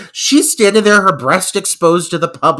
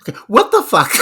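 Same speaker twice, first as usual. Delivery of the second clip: higher, laughing through the words; laughing disbelief; 9 semitones higher, 5 dB louder, faster than usual. Real, not acted. Host laughing at the abrupt kiss. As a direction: crack up in disbelief.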